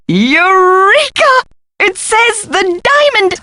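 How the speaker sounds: high, drawn-out cry of discovery; triumphant excitement. Acted. Professor reading a hidden message, high excited triumph. Exclaims at solving the puzzle.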